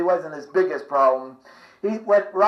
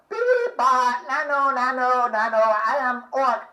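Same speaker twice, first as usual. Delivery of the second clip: higher, odd alien voice; alien Mork; 5 semitones higher, 5 dB quieter than usual. Retold or imitated. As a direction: beep like a cheerful spaceman from Ork. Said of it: "Robin Williams as Mort from Ork".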